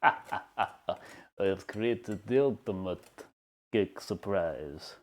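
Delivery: put-on slow, drawn-out Transylvanian vampire accent; lingering Dracula accent, savouring every syllable. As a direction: drag each word out like a Transylvanian count. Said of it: "That was just a Dracula accent".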